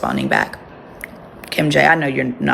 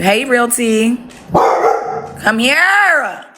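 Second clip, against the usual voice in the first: sing-song pet call; 7 semitones higher, much slower than usual. Real, not acted. Calling the dog sweetly.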